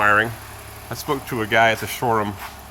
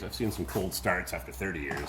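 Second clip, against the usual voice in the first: usual speaking voice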